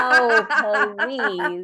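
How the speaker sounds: loud laughing protest. Real, not acted. Cohost brushing off her friend's self-putdown, laughing.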